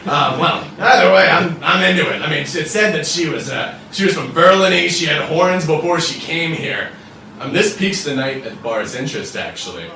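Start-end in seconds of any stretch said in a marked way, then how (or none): 0.1-6.9 slightly raised, loud-ish barfly chatter; lightly voiced blabbermouth lawman; brash devil officer, rambling. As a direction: ramble at a stranger, voice pushed up.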